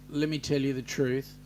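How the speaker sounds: normal talking voice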